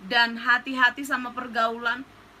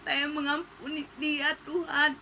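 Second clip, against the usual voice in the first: choked prayer; 5 dB quieter, slower than usual. Retold or imitated. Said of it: "saya cuman bilang gini".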